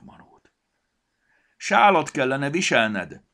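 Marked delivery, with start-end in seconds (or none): 1.6-3.2 loud high drawn-out shout; calling out. A narrator's reading. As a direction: call across a distance, high and loud. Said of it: "kiáltotta a kajtató manónak, kezéből sárga tölcsét formálva".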